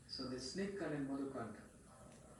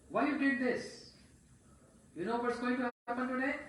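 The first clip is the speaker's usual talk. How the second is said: loud, high scolding yell; angry husband yelling an accusation; yelling at her; 11 semitones higher, 10 dB louder than usual. Acted. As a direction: scold her at full volume, much higher. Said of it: "yelling at her".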